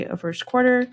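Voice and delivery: normal talking voice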